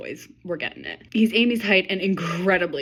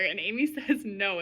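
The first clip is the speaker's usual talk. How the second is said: normal talking voice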